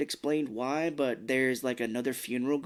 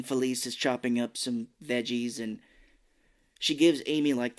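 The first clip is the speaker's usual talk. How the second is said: ordinary delivery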